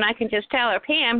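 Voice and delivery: normal talking voice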